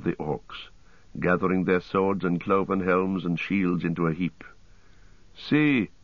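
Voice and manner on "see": quick discovering call; sudden cry. A narrator's reading. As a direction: point it out with a start. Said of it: "cried Aragorn".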